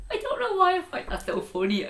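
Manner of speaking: helpless high-pitched laughing speech. Real, not acted. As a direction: squeeze the words out through real laughter. Cracks up at own joke again.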